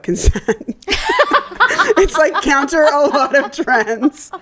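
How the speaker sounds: talking through laughter; laughing. Real, not acted. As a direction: laugh while you say it. Laughing over own joke.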